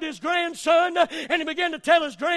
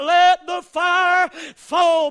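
slow, louder yell, drawn out; 7 dB louder, much slower than usual. Retold or imitated. Old man yelling at the mountain, slow loud yell. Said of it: "That old man jumped up and yelled".

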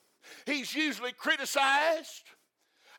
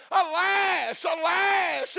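pleading lament; 6 semitones higher, 6 dB louder, much slower than usual. Shown as a demonstration. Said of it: "It's easier to demonstrate".

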